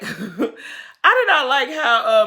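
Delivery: slow, loud words through laughter; laughing. Real, not acted. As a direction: laugh while saying it. Repeated while laughing, slower.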